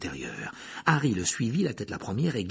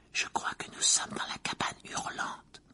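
scared whisper; 4 dB quieter than usual. Acted. Hermione fearful in haunted house.

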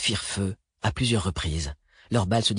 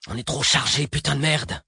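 raised, exasperated complaint; stressed swearing; 5 semitones higher than usual. Acted. Driver complains the fleeing car is overloaded, exasperated swearing. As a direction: curse in rising frustration.

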